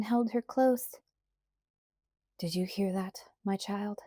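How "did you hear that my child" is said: soft, hushed question; quiet. A narrator's reading. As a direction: ask her softly. Father holds daughter close.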